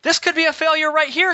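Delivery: high-pitched mock German accent. Pilot imitates Bruni objecting at engine start, high German accent. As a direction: imitate a frantic German woman, voice way up. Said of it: "she says in her German accent".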